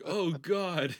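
high, loud comic wail; hammed-up cry of dismay; mock horror. Acts horrified climax reaction.

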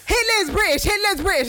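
heated insistence. Real, not acted. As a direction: push the point home, loud and high. Arguing over the character's accent.

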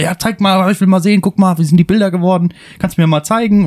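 high, eager begging to see photos; eager pestering. Retold or imitated. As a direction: play the impatient friends wanting a look. Retelling friends pestering to see photos.

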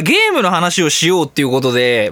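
ordinary talk, nothing marked

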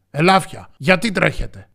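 loud lion's demand; forceful storybook interrogation; commanding question. A narrator's reading. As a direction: question them like a stern king. Lion interrogates the animals.

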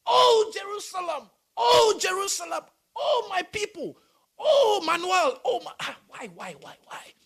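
high, loud wailing oh; a grieving God calling his people; God lamenting. Acted. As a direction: cry out in grief, then fade to why. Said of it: "So God says".

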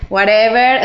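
lightly mimicked Venezuelan accent on English words; Caracas accent in English. She imitates how a caraqueña speaks English, light Venezuelan accent. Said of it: "es una caraqueña hablando en inglés".

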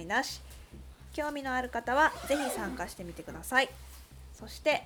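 bright radio announcement voice; announcement read. Acted. Reads club announcement.

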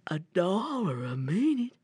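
amazed bear. Acted.

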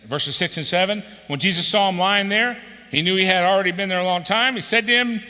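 loud, high, fervent scripture reading; booming pulpit reading; preaching at full force. Acted. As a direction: proclaim the passage to the whole hall.

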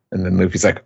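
ordinary talk, nothing marked

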